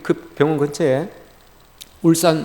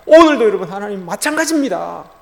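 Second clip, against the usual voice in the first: raised, louder plea to God; crying out; 5 semitones higher, 5 dB louder than usual. Acted. Preacher voicing a believer crying out to God, raised pleading. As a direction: call out in prayerful lament. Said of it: "부르짖어도".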